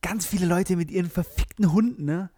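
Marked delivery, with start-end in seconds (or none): none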